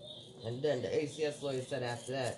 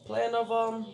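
imitating the judge; 8 semitones higher than usual. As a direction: ask it like the stern judge. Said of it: "she said".

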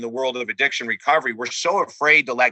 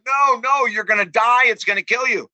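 freaked-out warning, voice jumping high; panicked cry; 8 semitones higher than usual. Retold or imitated. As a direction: plead in alarm that he'll die. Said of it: "Richard is freaking out".

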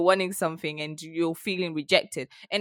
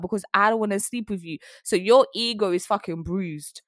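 ordinary delivery

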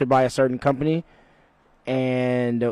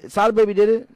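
excited; 8 semitones higher, 7 dB louder than usual. Real, not acted. Keeps hyping the rapper example.

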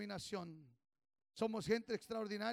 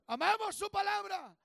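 higher, loud shouted "Amamos su palabra"; shouted preaching; 13 semitones higher, 10 dB louder than usual. Real, not acted. Preacher shouts the creed to the congregation, loud and high. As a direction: hurl "Amamos su palabra" out to the room.

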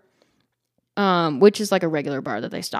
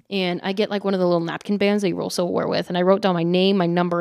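ordinary talk

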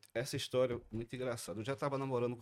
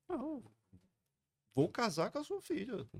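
much higher, earnest promise to the father; eager high reply; 13 semitones higher than usual. Retold or imitated. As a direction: pitch it up, eager to convince. He answers the father, retold.

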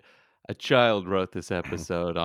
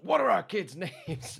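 mock angry yell; 8 semitones higher than usual. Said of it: "He goes".

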